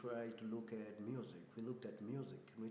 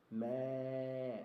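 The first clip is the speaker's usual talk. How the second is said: goat bleat. Shown as a demonstration. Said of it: "A goat".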